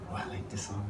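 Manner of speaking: ordinary talk, nothing marked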